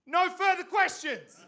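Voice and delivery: high, loud, slow, laughing; mock verdict. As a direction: declare it like a judge, laughing. Mock parents' reaction.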